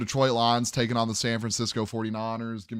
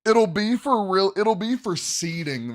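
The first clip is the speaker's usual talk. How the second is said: excited; 9 semitones higher, 5 dB louder than usual. Real, not acted. Hyped about the matchup.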